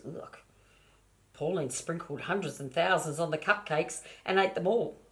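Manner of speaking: ordinary delivery, nothing marked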